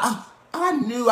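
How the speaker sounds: lightly mock a know-it-all claiming they knew; a hint of a faked know-it-all. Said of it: "Let's not".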